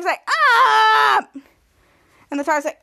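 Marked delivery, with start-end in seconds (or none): 0.3-1.3 high, loud "aah" scream; imitated scream. Schwoz screaming at the toddler, loud imitated scream. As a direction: act out a character's long scream. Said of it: "the toddler is screaming".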